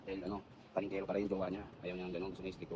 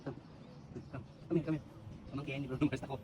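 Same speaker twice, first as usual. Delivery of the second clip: ordinary talk, nothing marked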